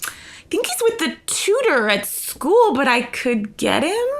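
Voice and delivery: high, slow, tentative squeak; hesitant mock reply. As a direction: stretch it out high, offering reluctantly. Singer cornered by boss, acted.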